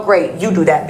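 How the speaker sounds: ordinary delivery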